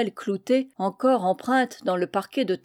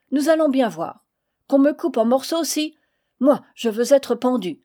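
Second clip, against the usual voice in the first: heated insistence; 4 semitones higher, 4 dB louder than usual. Acted. Heated quarrel over paying.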